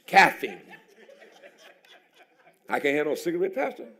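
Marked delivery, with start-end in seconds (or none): 0.1-0.6 Caffeine louder with a laugh. Acted. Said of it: "till I hit your house".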